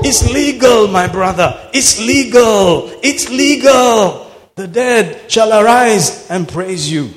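loud, rising sermon fervour; full-force preaching. Acted. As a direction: preach it hard to the crowd.

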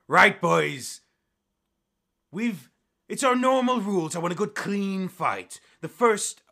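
loud, high announcing captain; captain addressing fighters. Acted. Captain giving two duelists the rules, loud and commanding. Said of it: "you see that the captain goes".

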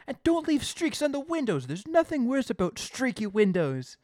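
high, loud, hammed-up order; bossy shop owner. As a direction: lecture a new helper with mock bluster. Acting Bart ordering Simon.

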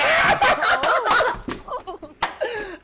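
high-pitched burst of loud laughter; real laughter. Real, not acted. Podcaster cracking up at his own anime reference, high loud laughter.

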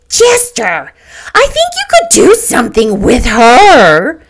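Mrs. McKee's eager outburst; breaking out. A narrator's reading. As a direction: burst out with a sudden bright idea. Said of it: "she broke out".

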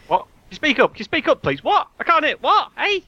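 high, loud straining to hear; to someone hard of hearing. Pretend. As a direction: shout back like a deaf old man. Mock shouting can't hear.

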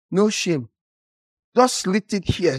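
usual speaking voice

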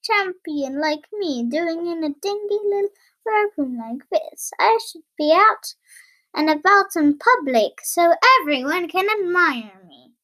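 high, self-admiring storybook voice; lilting high, showing off to the mirror; boastful. Acted. Tailor admiring himself in the mirror, boastful. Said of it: "It's really boasty".

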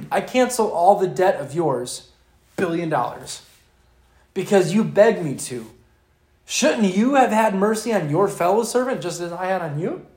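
loud, reproaching king, preached with force; the master confronting the unforgiving servant, loud rebuke; stern master's rebuke, preached. Acted. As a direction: scold him as the betrayed master.

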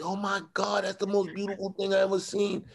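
high, soft imitation of gushing coworkers; mock gushing. Coworkers admiring his wife's flowers, mock gushing. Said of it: "coworkers would go crazy".

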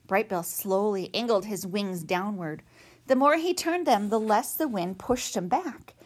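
plain reading voice

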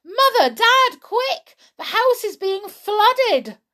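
high, loud call for the parents. Acted. Children calling their parents about the flood, loud. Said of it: "they cried".

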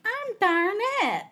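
put-on high, slow, sugary girlish voice; mock-cutesy introduction, drawn out up high; cutesy, non-threatening. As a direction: play her sweetly harmless and cute. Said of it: "She kinda had that cutesy, like, not threatening".

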